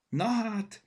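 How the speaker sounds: high, loud exclamation; surprised. A narrator's reading. Mother catches sight of the drawing, surprised. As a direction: exclaim in surprise, pitch jumping up. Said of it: "lepődött meg anya".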